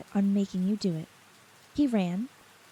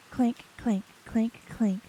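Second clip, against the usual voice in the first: ordinary delivery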